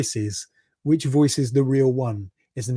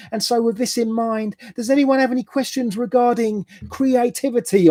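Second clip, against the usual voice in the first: high, bright presenter voice; raised-pitch mock facilitator; workshop coordinator voice; 9 semitones higher than usual.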